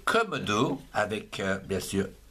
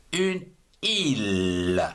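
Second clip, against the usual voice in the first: slow, careful modelling; 4 semitones lower, slower than usual. Shown as a demonstration. Teacher models the word slowly.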